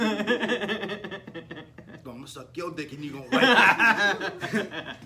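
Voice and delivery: high, loud, bursting into laughter; hammed-up bluster through laughs; laughing mock threat. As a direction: deliver the joke threat while cracking up. Capping the joke while laughing.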